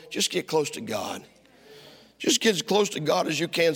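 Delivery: ordinary talk, nothing marked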